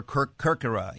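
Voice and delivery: Kerkyra said "KUR-kur-uh" with American r sounds; American pronunciation. Shown as a demonstration. Guest offering an alternative, Kerkyra in bland American pronunciation. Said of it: "a bland American pronunciation".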